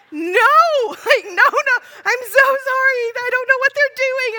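high, loud, flustered denial; mortified parent scrambling to explain; frantic apology. Retold or imitated. Mom apologizes to the mistaken stranger, loud and panicked.

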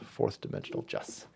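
a smug little whisper. Real, not acted. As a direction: breathe it like a secret. Repeating quietly.

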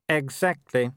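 lightly voiced young werewolf noble, cool; a hint of the arrogant son; Wulf's voice. A narrator's reading. Son answering his mother, lightly voiced young noble.

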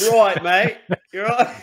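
hammed-up British street accent; mock British geezer. Joins the mock British geezer bit.

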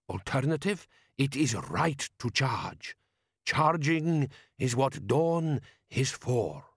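slow, emphatic foreign warrior voice; insistent Jabbar. Acted. Jabbar rejecting another approach, slow and insistent. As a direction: insist on it, drawing the words out.

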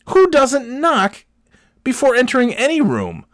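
high-pitched incredulous outrage; indignant rant. Retold or imitated. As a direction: rant in exasperated disbelief.

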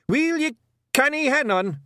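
high, loud Scottish shout; exasperated Scottish holler. Acted. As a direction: yell in exasperation as a little Scotsman. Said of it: "shouted Rob Anybody".

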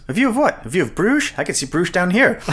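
high-pitched, scoffing imitation; mock incredulous retort. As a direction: act out an exasperated, incredulous comeback. Acted dismissive retort from the film.

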